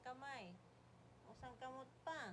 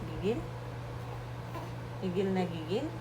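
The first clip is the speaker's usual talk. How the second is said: loud, lower squeal of gigil; loud cute aggression; 3 semitones lower, 17 dB louder than usual. Real, not acted. Mother squeezing her baby, loud cute aggression.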